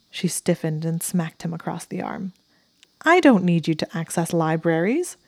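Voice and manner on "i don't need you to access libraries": loud, indignant scoff with a rising end. A narrator's reading. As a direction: scoff as if insulted by the suggestion. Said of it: "she scoffed".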